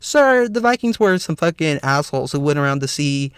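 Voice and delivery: ordinary talk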